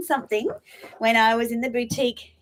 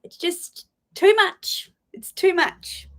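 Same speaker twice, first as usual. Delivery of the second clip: teary; 9 semitones higher, 4 dB louder, slower than usual. Real, not acted. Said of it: "I'm gonna get teary now".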